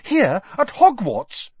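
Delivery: can't believe it's here; shocked question. A narrator's reading. Hermione shocked, disbelieving question.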